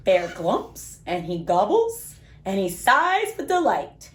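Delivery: put-on lively, sing-song storybook read for little kids; reading to children. A narrator's reading. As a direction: read it like bedtime story time for small children. Reads picture book to kids.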